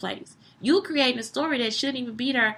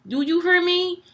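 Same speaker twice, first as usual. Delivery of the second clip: ordinary talk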